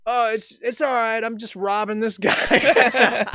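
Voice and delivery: high, loud cheeky call back; smart-ass shout. Retold or imitated. Joker answering the guard across the lot, smart-ass yell. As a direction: holler it back breezily as a joke. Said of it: "me being a smart ass".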